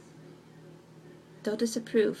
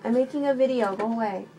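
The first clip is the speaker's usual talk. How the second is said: calling out; 3 semitones higher, 7 dB louder, faster than usual. Real, not acted. Shooing away someone walking in.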